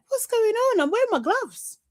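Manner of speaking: high, incredulous self-quote; mock exasperation. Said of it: "I'm just like".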